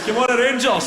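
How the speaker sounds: loud, high football-fan yell; mock fan's full-voiced shout. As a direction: holler for your team as you pass. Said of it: "He shouts".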